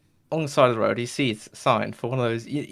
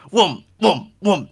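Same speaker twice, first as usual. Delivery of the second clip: high, loud imitated whooms; forceful, swooping whooms in quick succession; aggressive coffin noise; 7 semitones higher, 5 dB louder, slower than usual. Coffin chases Tyler onto the road, aggressive whooming. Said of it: "It's almost more aggressive this time".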